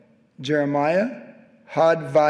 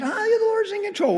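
reassuring high. Retold or imitated. Pastor reassuring worked-up congregant.

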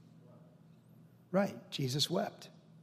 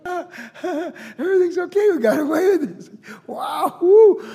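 high, laughing, then a loud whoop; laughing relief; 13 semitones higher, 8 dB louder, slower than usual. Retold or imitated. Brothers at the feast, laughing high with relief. Brothers thinking they escaped.

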